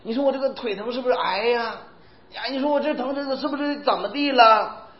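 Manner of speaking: put-on mildly anxious elderly whine; worried old person. Elderly parishioner worries aloud about her aching leg, softly fretful. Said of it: "他会疑惑".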